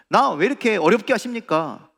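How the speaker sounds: loud protest. Acted. Troubled believer demanding why God makes it difficult, loud protest. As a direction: protest loudly to God, voice pushed up. Complaint to God repeated, escalating.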